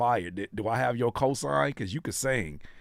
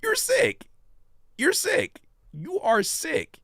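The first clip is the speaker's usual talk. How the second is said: high squeaky mock scold; squeaky teasing; 4 dB louder, slower than usual. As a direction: squeak it out like a playful tease. Teasing repeat to chat.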